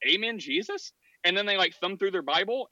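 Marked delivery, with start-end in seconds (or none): 0.1-0.9 higher, tentative asking Amen, Jesus; a mock new believer unsure of the words; hesitant convert. Convert tries the phrase.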